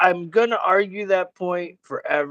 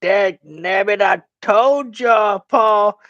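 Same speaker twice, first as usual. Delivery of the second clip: mock Southern yell; 2 semitones higher, 4 dB louder, slower than usual. Friend mocking a Southern drawl, slow and loud. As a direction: ham up a country dad yelling. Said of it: "yell at your dude like".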